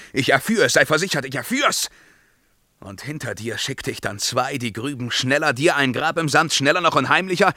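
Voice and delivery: king threatening the gravedigger with death; menacing threat. Acted. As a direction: press the threat harder and harder.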